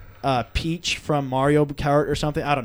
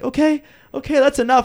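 high, louder mock-urgent dad; fed-up dad; 10 semitones higher, 5 dB louder than usual. Dad stopping the kids shoveling candy, high flustered insistence. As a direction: imitate a parent cutting the kids off.

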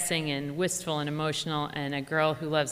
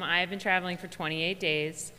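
normal talking voice

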